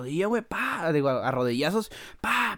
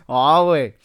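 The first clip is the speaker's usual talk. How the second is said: normal talking voice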